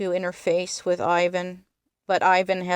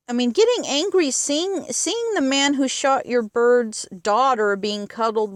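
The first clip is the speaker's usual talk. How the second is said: worked up; 6 semitones higher, 3 dB louder than usual. Real, not acted. Speaker gets worked up defending kid.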